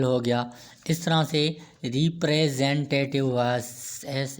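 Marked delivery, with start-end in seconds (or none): 1.8-3.3 रिप्रेजेंटेटिव slowly parted as ree-pre-zen-te-tiv; each syllable of रिप्रेजेंटेटिव given its own beat. Shown as a demonstration. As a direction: sound out रिप्रेजेंटेटिव in measured syllables. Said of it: "many movements के साथ".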